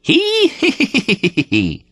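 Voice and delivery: very high, slow manic giggle; mad laugh. Acted. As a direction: cackle like a madman. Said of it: "I heard his mad laugh".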